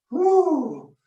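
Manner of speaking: high bright cheer to pump up the class; excited whoop. Real, not acted. Coach whooping to cheer on the class, excited. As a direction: let out a big high whoop.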